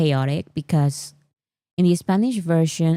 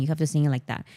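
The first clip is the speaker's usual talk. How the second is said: usual speaking voice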